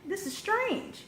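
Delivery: high, soft puzzled murmur to herself. Retold or imitated. Woman coming home to find something off, puzzled. Acting out her puzzled homecoming.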